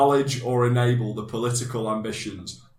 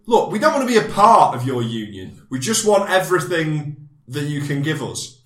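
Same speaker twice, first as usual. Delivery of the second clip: loud, high and pushy; bossy demanding voice; 6 semitones higher, 10 dB louder than usual. Pretend. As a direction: demand it like an entitled negotiator. Voicing the government demanding.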